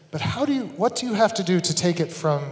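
ordinary delivery

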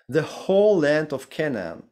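ordinary talk

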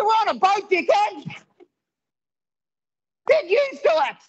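very high, exasperated comic protest; exasperated retort. Retold or imitated. Comedian to the passenger who says he's stalking, mock exasperation.

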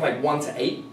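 ordinary delivery

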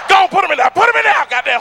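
high, loud hurry-up; loud greedy demand; impatient shout. Retold or imitated. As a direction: urge the clerk to rush the donuts. Hurrying the donut clerk.

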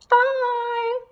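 high little Yeti puppet saying bye. Pretend. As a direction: wave goodbye in a high puppet voice. Puppet Yeti says goodbye.

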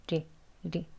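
soft tapped "리" drill. Shown as a demonstration. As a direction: tap "리" gently, tongue relaxed. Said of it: "치경에 닿아서".

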